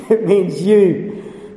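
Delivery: slow, pointing at each listener; slow pointed repeat. Shown as a demonstration. Preacher drives home to the congregation, slow and pointed. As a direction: drag it out, every word aimed.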